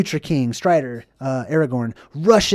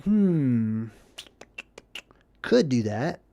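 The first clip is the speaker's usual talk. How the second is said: musing hesitation; 4 semitones lower, 6 dB quieter, much slower than usual. Retold or imitated. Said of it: "Frodo's like".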